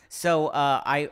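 normal talking voice

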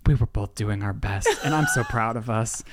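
low, affectionate retold reassurance; tender making up. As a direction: drop the pitch, acting sweetly proud. Retold making up after the yelling.

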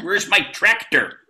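mock rural American accent. Shown as a demonstration. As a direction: play a Canadian faking a farmer. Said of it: "Canadian actor being a rural American".

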